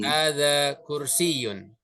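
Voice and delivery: Hadza baitun drawn out slowly, syllable by syllable; a teacher modelling Arabic for students to repeat; slow model pronunciation. Shown as a demonstration. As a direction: say Hadza baitun slowly so the class can copy. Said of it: "Ikuti saya".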